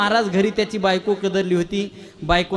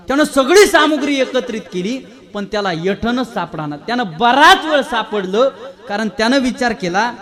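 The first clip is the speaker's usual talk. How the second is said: ordinary delivery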